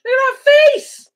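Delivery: very high, loud gushing squeal; delighted squeal. Real, not acted. Owner reacting to her dog's face, delighted squeal. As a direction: squeal it, tickled by the pet's reaction.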